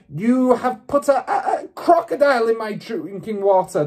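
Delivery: high, loud sputtering yell; yelled back. A narrator's reading. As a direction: yell the accusation, tripping over words. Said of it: "the Trunchbull yelled back".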